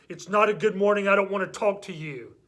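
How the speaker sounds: put-on mad kid. Mad child answering a friend's good morning, angry. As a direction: act out a child biting back at a greeting. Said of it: "and they're mad".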